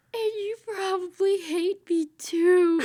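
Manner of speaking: high, loud, slow hammed-up whine; mock crying Spock. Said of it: "he goes".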